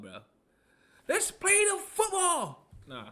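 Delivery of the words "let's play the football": high, drawn-out sportscaster catchphrase; Mad Dog. Acted. Fan quoting a favourite sports host, high drawn-out impression. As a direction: stretch it out, pitched way up. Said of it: "I love Mad Dog".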